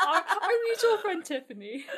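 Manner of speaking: giggling through the name, pitch way up; laughing at the fake name. Real, not acted. Laughing as she renames her friend for the podcast.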